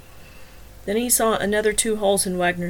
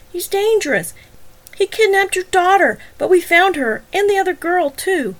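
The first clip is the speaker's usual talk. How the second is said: much higher, louder pressing; shrill urging; 11 semitones higher, 6 dB louder than usual. Acted. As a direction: push the detective to shoot. Wagner urging shooting Joe.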